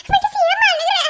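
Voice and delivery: high, loud tearful cry; tearful soothing. Acted. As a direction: weep while comforting. Mother soothes crying son. (அழுகையுடன் ஆறுதல்)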